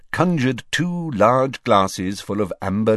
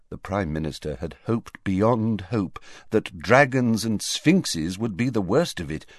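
usual speaking voice

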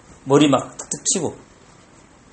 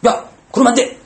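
sharp raised scold, higher and a bit louder; harsh scolding; 6 semitones higher, 3 dB louder than usual. Shown as a demonstration. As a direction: snap at a child like a stern parent. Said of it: "애들은 무서워요".

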